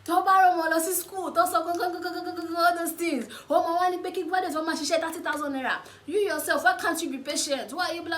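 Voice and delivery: imitating an upset mother ranting high and loud; a Yoruba mum scolding in full flow, high and fast; mock angry mum. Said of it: "spitting Yoruba".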